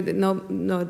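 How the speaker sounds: ordinary delivery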